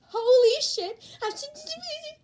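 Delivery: very high, loud stunned cry; shocked outburst. Acted. Mina shocked at Deku's leg press, stammering.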